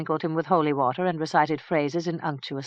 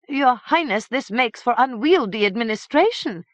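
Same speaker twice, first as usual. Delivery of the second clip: higher, louder pleading priest's bleat; bleated protest; 8 semitones higher, 5 dB louder than usual. A narrator's reading. Priest protests to the king, bleating higher and louder. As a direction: protest like a startled sheep. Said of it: "Father Umfred bleated a protest".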